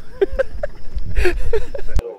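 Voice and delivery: loud squealing laugh; high-pitched laugh. Real, not acted. Laughing at his own joke.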